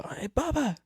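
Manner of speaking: soft, high-pitched imitation of grandma calling. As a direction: call out lightly in a high grandma voice. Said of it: "I hear this voice go".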